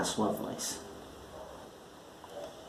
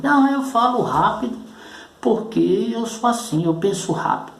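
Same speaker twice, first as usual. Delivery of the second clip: higher-pitched mock self-justification; protesto imitado; 11 semitones higher than usual. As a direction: act out someone insisting on an excuse, pitched well up. Voz de quem se justifica. (imitando alguém se justificando, em voz aguda)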